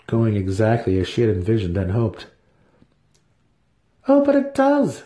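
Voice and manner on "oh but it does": lifted high, silky matron voice; a sly, high-pitched matriarch; Santa Fe's voice. A narrator's reading. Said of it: "she responded".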